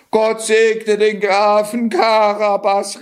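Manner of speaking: high, slow cheering woman; woman calling out. Acted. A village woman calling out blessings, high and slow. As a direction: cheer the count as the carriage passes. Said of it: "rief eine Frau".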